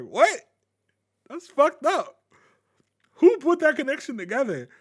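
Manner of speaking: high, loud retold outrage; shocked disbelief. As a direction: act out an appalled reaction. Retold shocked reaction to a discovery.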